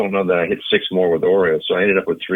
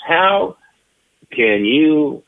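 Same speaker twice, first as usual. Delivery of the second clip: mock yell, high and drawn-out; retold angry outburst, each word hit hard; imitating Ted Williams yelling; 9 semitones higher, 3 dB louder, much slower than usual. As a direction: act out an incredulous veteran's tirade. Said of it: "And he's yelling".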